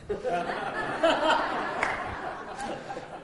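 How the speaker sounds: soft airy laugh; long quiet falling chuckle; real laugh, almost silent; breathy laugh. Real, not acted. Laugh during phone call.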